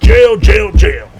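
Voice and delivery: loud, punched repetition; mock harping. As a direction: hammer each word, mocking. Mimics cousins harping on jail.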